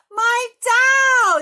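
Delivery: very high, very loud joyful cry; boy reunited with his doll; happy yell. Acted. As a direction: shout it with pure delight. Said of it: "yelled Harry happily".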